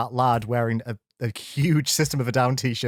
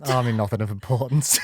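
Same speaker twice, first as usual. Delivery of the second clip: ordinary talk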